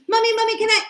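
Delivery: put-on high, pleading little-kid voice; whining child. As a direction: imitate a child begging mommy. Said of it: "When she goes".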